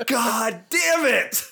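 slow, high, drawn-out mock groan; mock frustration. Real, not acted. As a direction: stretch it out in comic exasperation. Mock frustration after being wrong.

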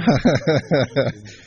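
nervous laugh. Real, not acted. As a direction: laugh at your own struggle to speak. Laughs at how hard this is.

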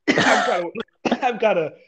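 coughing through the words; hacking cough mid-sentence; coughing fit. Real, not acted. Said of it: "weird cough I've been dealing with".

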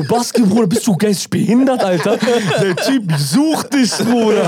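hoch und lachend, Kumpel auslachen; laughend gespielt. Retold or imitated. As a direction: lach ihn ungläubig aus. Laughing rebuke to friend. (lachend zu Baske: Bist du verrückt)

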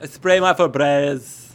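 hammed-up line, "Febreze" with a short final e; joke mispronunciation. Friend jokes about the spelling, drawing out "Febreze" as "feh-BREZ". Joking on the misspelt brand.

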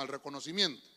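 ordinary talk

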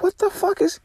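high-pitched what-the-hell; incredulous outburst. Retold or imitated. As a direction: jump high, can't believe it. Buyer's podcast works perfectly.

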